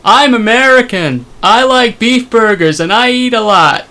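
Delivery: high, slow mock American voice; hammed-up dim American, drawn out and high; American accent. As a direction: put on a caricature American accent. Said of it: "do our very own American accents".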